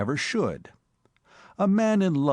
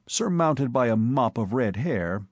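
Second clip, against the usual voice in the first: ordinary delivery, nothing marked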